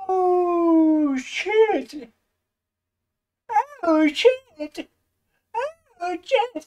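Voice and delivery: high, drawn-out exclamation; stunned disbelief. Real, not acted. Streamer reacting to opponent's blunder, stunned high wail. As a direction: stretch it out high in shock.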